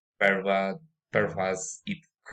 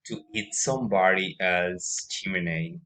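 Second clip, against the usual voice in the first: ordinary delivery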